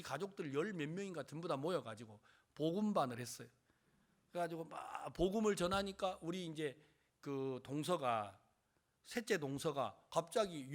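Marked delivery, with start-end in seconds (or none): none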